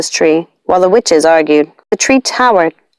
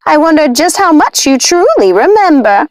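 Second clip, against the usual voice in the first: high, sly witch voice with a smile; smiling witch; 8 semitones higher than usual. A narrator's reading. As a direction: needle her with a smile. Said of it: "She smiled".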